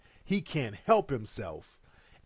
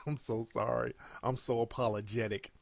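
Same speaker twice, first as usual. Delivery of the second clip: mocking the apology; 3 semitones lower, 6 dB quieter than usual. Mocking Sterling's apology after laughing.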